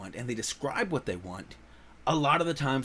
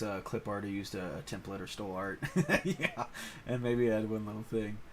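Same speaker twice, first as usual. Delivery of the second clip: ordinary delivery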